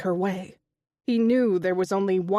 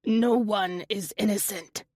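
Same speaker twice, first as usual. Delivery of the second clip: grumbling. A narrator's reading. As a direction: grumble it darkly. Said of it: "Otto grumbled".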